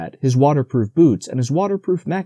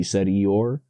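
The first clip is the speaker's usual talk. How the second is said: usual speaking voice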